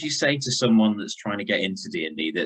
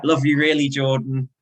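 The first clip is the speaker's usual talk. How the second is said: laughing while teasing a friend; fond teasing through a laugh; 5 semitones higher than usual. Real, not acted.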